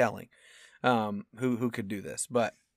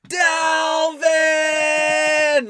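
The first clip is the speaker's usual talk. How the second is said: long, high shout holding each syllable; exasperated cartoon-dad yell; 13 semitones higher, 5 dB louder than usual. Fan watching his pick get ruined, exasperated cartoon-dad yell. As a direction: holler the name like a cartoon dad at his chipmunk. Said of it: "the dad from Alvin and Chipmunks".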